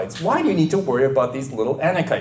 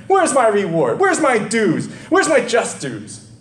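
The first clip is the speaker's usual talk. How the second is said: mock self-pitying gripe; hammed-up high whining about getting nothing; whiny complaint; 5 semitones higher than usual. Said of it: "That's how Moses sounded".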